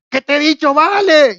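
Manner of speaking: loud, high, fed-up yell; exasperated shout. Retold or imitated. Worker at the far end losing patience, loud high exasperated yell. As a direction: shout as if he's told him twice.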